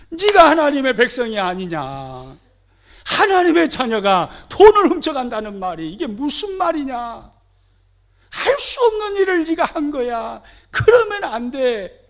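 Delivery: loud, high rebuke of a son; stern scolding. Retold or imitated. Mother rebuking her thieving son, loud and stern. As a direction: scold him hard, voice near tears. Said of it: "호되게 혼내고 책망하고 질책해야".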